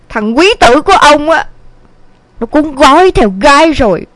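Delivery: mildly acted, aggrieved lament; wife's tirade. A narrator's reading. Wife tells husband their son ran off, exasperated and lamenting. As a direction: unload complaints on husband with exasperation. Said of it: "bắn như mưa vào ông".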